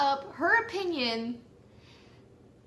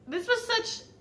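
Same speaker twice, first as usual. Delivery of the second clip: normal talking voice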